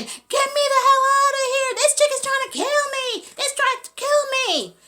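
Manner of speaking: high, loud panicked scream for the guards. Retold or imitated. Cellmate screaming at the guards to get her out. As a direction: shriek to be let out. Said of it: "she's screaming".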